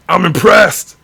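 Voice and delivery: loud, high exclamation; excited. Real, not acted. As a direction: burst out with real delight. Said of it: "then I'm impressed".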